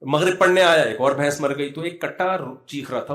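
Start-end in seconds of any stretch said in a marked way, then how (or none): none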